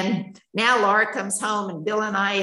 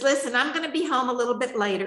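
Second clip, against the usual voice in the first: quick, higher-pitched casual imitation; breezy, carefree; 5 semitones higher, faster than usual. Daughter phoning mom as if nothing had happened, breezy imitation. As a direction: toss it off fast and blithe. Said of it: "like nothing had happened".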